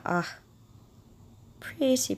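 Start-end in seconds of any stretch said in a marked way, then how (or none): 1.6-2.2 delighted pretty. Real, not acted. Admiring a pink spray.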